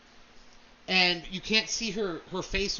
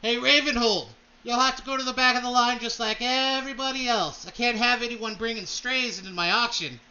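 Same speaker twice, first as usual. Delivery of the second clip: higher, louder haughty noble voice called down from above; Kalen Blackthorn; 5 semitones higher, 5 dB louder than usual. Acted. Blackthorn calling down from the balcony to the party, haughty and louder. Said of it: "you hear this voice say".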